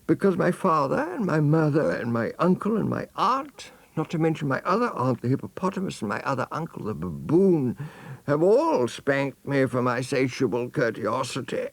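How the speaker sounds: high, eager little voice; elephant's child. Acted. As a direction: rattle off the relatives like an innocent child. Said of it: "said the elephant's child".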